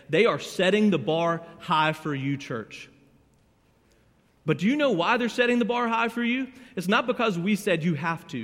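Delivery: loud, high, rising sermon delivery; full-force preaching. Acted. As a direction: drive the point home to the congregation. Preacher builds to a climax.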